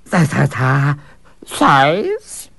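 flustered stammer on ta, ta, ta. Acted. Servant caught off guard stammering at the fool. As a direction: trip over the first sounds. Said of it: "stammered the servant".

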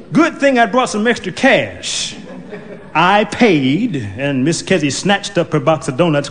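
plain reading voice

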